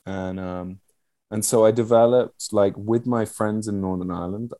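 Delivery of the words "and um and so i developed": normal talking voice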